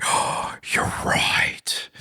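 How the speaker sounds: put-on hushed, breathy wonder of a kid; a small boy's awed hush; awed whisper. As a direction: whisper it in amazement. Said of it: "he got all perked up, like".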